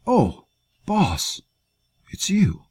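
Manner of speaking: high, strained voice of a wounded fighter; wounded man's voice. Acted. Wounded soldier greets his boss, high and strained. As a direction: push the words out through pain. Wounded Ost wakes weakly.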